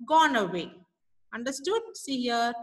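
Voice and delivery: usual speaking voice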